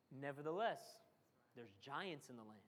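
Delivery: a fearful spy muttering about giants. Retold or imitated. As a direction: drop the voice low and soft like fear talking. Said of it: "that's the way fear sounds".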